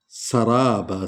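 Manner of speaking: सराबा with a hissing, whistled seen; whistling seen. Shown as a demonstration. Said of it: "सीन को सीटी की आवाज़ निकलेगी". (सराबा में सीन की सीटी)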